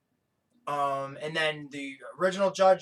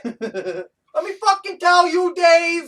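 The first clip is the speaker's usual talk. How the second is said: much higher, louder, slow Long Island shout; Michael Rapaport impression; 13 semitones higher, 11 dB louder, much slower than usual. Podcast host imitating Michael Rapaport, loud Long Island shout. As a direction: bellow it like Rapaport losing it. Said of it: "I can't do an accent like his".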